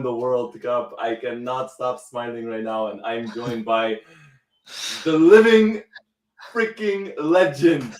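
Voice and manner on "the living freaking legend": high loud build-up, slowing; big emphatic hype; hype introduction. Real, not acted. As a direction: ecstatic host intro. Hyping up his co-host.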